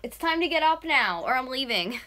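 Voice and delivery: loud, high ultimatum; threatening. Real, not acted. Threatening to leave sleeping boyfriend, loud warning. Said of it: "I threaten him a lot too".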